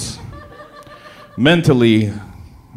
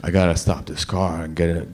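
ordinary delivery

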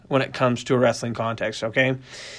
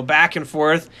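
usual speaking voice

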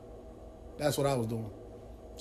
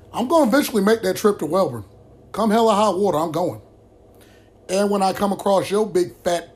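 furious threat; 5 semitones higher, 6 dB louder than usual. Real, not acted. Threatening the brother on camera.

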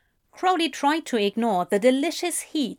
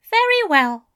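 Aziraphale voice; 9 semitones higher, 5 dB louder than usual. A narrator's reading.

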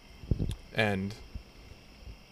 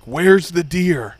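loud, drawn-out angry demand, higher pitched; pissed-off trooper; 5 semitones higher, 8 dB louder, much slower than usual. Retold or imitated. Trooper arriving at a deer crash, imitated as pissed off. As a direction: imitate an irritated cop demanding answers slowly. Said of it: "he was fucking pissed".

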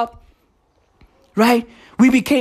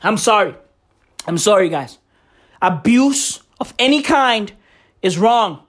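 ordinary talk

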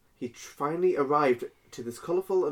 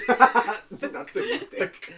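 laughing; 11 semitones higher, 4 dB louder, much slower than usual. Real, not acted. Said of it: "laughing denial".

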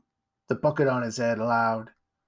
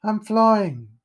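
higher, louder joyous cry; exhilarated; 9 semitones higher, 6 dB louder than usual. Acted. Jack falling, thinking with joy. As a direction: let it soar with release. Jack's last thought.

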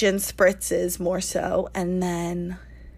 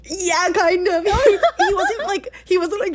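high, breaking into laughter; laughing; 11 semitones higher, 4 dB louder than usual. Real, not acted. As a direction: talk through giggles. Laughing while retelling.

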